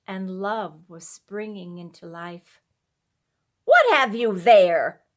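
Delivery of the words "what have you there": loud, sharp demand; sharp question. A narrator's reading. Wife sharply challenges husband carrying sick child. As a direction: snap the question at him. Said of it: "sharply questioned Missus Thompson".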